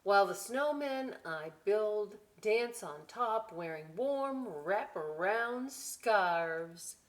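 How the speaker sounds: put-on slow, lively read-aloud to children; storybook voice, slow and bright; story reading. A narrator's reading. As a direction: savour each line for the kids. Reading book.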